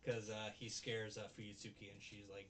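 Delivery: ordinary talk, nothing marked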